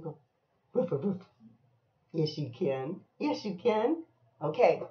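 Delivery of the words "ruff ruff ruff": short modest barks; little ruff-ruff; dog imitation. Shown as a demonstration. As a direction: show a child how to bark. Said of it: "Can you bark?".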